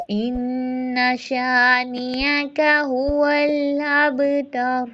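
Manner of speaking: slow, melodic tajweed recitation; Quran recitation. Acted. Teacher recites surah line by line for repetition. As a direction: chant each verse slowly for students to repeat.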